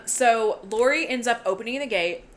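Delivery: ordinary talk, nothing marked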